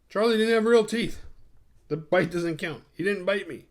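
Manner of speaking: high, loud retold discovery; acted-out high-pitched elation; excited mock relief. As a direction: announce it high, as a great reprieve. Retold relieved discovery of fake teeth.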